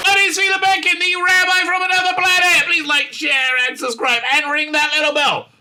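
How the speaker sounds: cheery channel-outro announcer read; announcer sign-off. Acted. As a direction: sell the subscribe like a host.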